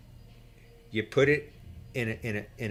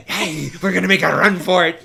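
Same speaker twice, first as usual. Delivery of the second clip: louder, eager and pumped; excited announcement; 4 semitones higher, 8 dB louder than usual. Retold or imitated. Excited crew shouting they'll outrun the gunship, pumped call. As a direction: call it out like the crew is thrilled to run.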